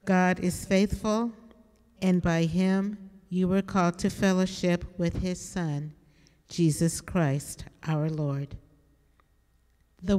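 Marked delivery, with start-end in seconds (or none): none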